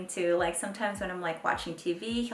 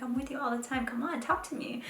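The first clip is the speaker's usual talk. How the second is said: high, softer whiny plea; mock-begging mom to her toddler; playful pleading; 6 semitones higher, 5 dB quieter, faster than usual.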